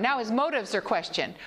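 ordinary delivery

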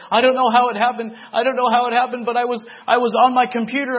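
high, loud, desperate voice; father calling his son, loud high distress; distraught father. Retold or imitated. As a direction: sound frantic and shaken.

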